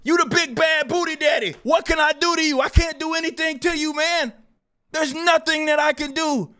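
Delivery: high-pitched exasperated protest; mocking helplessness. Streamer telling his big bad accuser he is harmless, mocking helplessness. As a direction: plead harmlessness with sarcastic disbelief.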